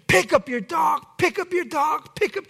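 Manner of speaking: high panicked shouting; frantic yell. Retold or imitated. Grandmother yelling from her driveway, high and frantic.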